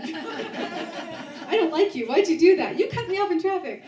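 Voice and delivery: high, loud, laughing mock complaint; mock annoyed stranger. Irritated strangers snapping at him, hammed-up and laughing. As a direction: ham up a grumpy stranger through laughter. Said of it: "No, they were like".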